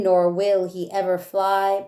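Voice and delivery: ordinary delivery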